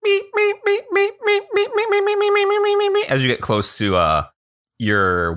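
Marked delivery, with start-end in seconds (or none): 0.1-3.1 very high squeaky bat meeps; bat squeaks. Acted. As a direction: squeak like a tiny bat through a laugh. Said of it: "whatever echolocation sounds like".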